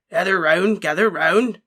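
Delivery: big, loud, warm half-giant calling the class in; rumbling giant beckoning his pupils; encouraging Hagrid. A narrator's reading. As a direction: Hagrid rallying nervous students, loud and hearty. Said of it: "Hagrid encouraged".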